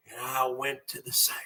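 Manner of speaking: fast, unvoiced whisper. Sudden drop mid rant.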